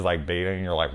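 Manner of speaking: ordinary talk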